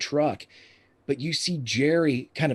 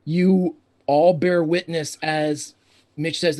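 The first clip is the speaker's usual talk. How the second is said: ordinary talk, nothing marked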